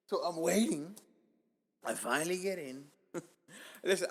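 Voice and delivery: ordinary talk, nothing marked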